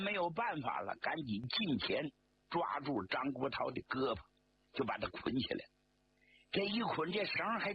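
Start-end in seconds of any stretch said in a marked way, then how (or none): none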